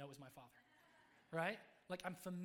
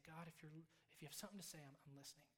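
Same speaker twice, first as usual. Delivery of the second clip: softer hushed retold prayer; quiet prayer; 8 dB quieter, faster than usual. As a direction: murmur it like a private prayer.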